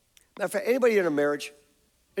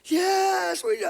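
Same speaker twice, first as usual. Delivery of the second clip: much higher whiny "yes"; mimicking daughters; 13 semitones higher, slower than usual. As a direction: squeak like a tearful teen. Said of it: "Wait, she yelled at you guys".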